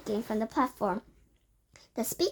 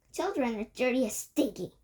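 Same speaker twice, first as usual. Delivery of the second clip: usual speaking voice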